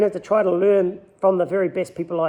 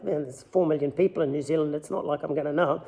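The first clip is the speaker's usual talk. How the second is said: lower, softer aside to himself; inner thought; 4 semitones lower, 7 dB quieter, faster than usual. Retold or imitated. Angler privately doubting he'd know the mate, low inner thought. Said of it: "I'm thinking to myself".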